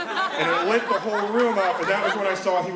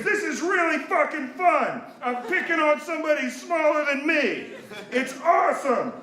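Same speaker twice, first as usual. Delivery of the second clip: high, raised-voice mock glee; gloating dad; 10 semitones higher than usual. Dad gloats over pinned bully.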